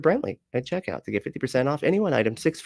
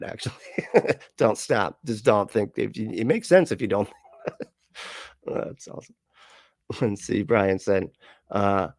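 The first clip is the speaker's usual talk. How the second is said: ordinary delivery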